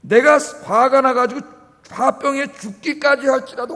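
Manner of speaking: high, drawn-out mock indignation; mock defiance. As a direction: imitate someone defiantly insisting they are right. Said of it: "이렇게 덤비는데도".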